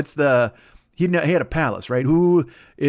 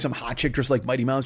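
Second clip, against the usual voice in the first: ordinary talk, nothing marked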